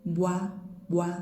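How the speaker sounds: "wa" with rounded lips, no teeth; wa sound. Shown as a demonstration. Teacher showing the wa alternative.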